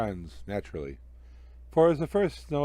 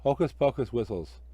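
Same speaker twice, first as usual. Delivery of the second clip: ordinary delivery, nothing marked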